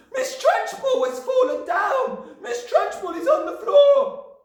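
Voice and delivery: very high, slow, loud shriek; screaming Wilfred. A narrator's reading. Wilfred announcing the headmistress's fall, high excited scream. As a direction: scream the news to the whole room.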